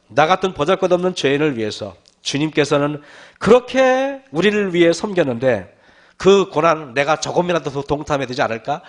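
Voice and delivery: normal talking voice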